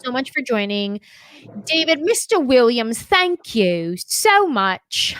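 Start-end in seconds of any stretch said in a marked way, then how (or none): 1.7-5.2 drawn-out, hammed-up British thank-you; slow British accent, stretched out; put-on British accent. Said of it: "I have to give you the accent one more time".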